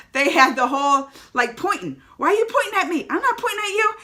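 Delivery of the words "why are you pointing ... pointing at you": high, loud cartoonish squabble; mock cartoon bickering. Fan acting out two Spider-Men pointing, mock-indignantly. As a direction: pitch it way up in playful indignation.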